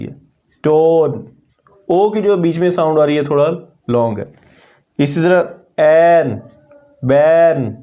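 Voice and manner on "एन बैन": एन and बैन with a lengthened vowel; long vowel demo. Shown as a demonstration. Teacher demonstrating a long vowel, stretched and slow. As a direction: draw out the vowel in एन, बैन, slowly. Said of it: "ए की थोड़ी long sound है".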